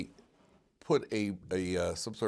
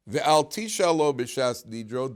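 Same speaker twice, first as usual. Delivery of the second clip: Mishnah recitation; 4 semitones higher, 7 dB louder, slower than usual. Shown as a demonstration. Chanting the Mishnah text.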